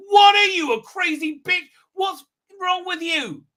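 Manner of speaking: high-pitched exasperated outburst; hammed-up scolding; mock outraged rant. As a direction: burst out high, as if confronting a lunatic. Acted angry outburst at a character.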